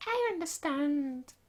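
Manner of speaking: high, airy commander dismissing a messenger; lofty noble voice. Acted. Commander dismissing the messenger, high, gracious voice. Reads character lines in voice.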